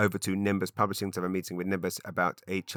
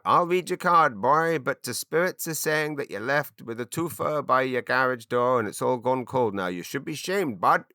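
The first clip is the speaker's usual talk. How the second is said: badly done East Coast Canadian accent, pitched up; 5 semitones higher, 4 dB louder than usual. Shown as a demonstration. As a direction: kitchen witch in a broad, wonky East Coast Canadian accent. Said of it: "what I'm trying to do is an East Coast Canadian accent".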